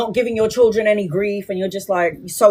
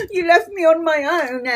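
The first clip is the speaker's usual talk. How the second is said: high, mock-tearful whine as mum; mimicking mum, high and crying; whiny mum; 6 semitones higher than usual. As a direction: imitate a clingy mother's whimper. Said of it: "my mum would be like".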